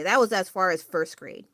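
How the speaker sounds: ordinary talk, nothing marked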